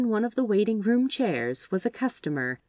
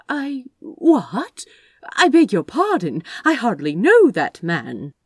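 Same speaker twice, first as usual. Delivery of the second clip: startled protest; 5 semitones higher, 8 dB louder than usual. Acted. Flustered at marriage prophecy.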